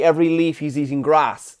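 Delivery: ordinary talk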